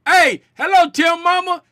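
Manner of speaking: loud, high, casual holler; Spider Gang talk. Retold or imitated. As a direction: imitate a rowdy fan's familiar hello. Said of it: "that's how the Spider Gang talk".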